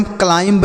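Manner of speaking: "क्लाइंब" with the final B pronounced; wrong, sounded B. Shown as a demonstration. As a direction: say "क्लाइंब", voicing the silent B at the end. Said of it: "यहाँ पर भी बी silent है".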